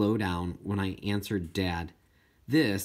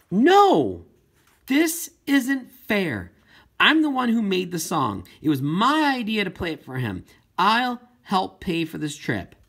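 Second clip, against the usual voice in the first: shouted No, then loud, high protest; angry shout; 8 semitones higher, 6 dB louder than usual. Acted. Girl to her parents about the trip, shouting in protest. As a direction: erupt and refuse to be placated. Girl refuses mom's popcorn offer.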